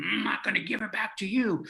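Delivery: a hint of a stubborn clown, rushed; clown character. Clown keeping the rope from the man, lightly clownish defiance. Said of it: "still in my clown character".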